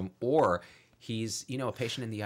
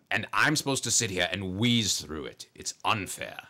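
Vader voice, wheezing and louder in bursts; Doc Vader rant; 4 dB louder than usual. Acted. Doc Vader complains he cannot be vaccinated, wheezing. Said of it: "he's like".

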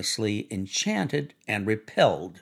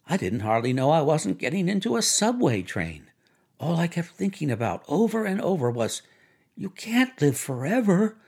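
excited woman's voice, pitched up; higher, breathless-with-excitement storytelling; Myrtle reliving excitement; 5 semitones higher than usual. Acted. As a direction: relive the thrill in a raised voice. Myrtle tells her story.

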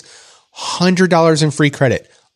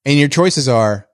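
ordinary talk, nothing marked

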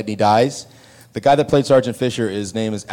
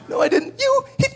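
much higher, frantic babbling; panicked high voice. Retold or imitated. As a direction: fluster in shock after the punch. Denying he hit her.

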